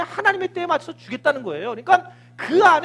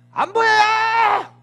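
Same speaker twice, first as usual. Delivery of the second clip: high, slow drawn-out mock yell; hammed-up servant calling down; servant's shout; 8 semitones higher, 5 dB louder, much slower than usual. Servant shouting down to Elijah from the mountain, mock yell. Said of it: "그랬더니 사환이".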